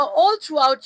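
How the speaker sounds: normal talking voice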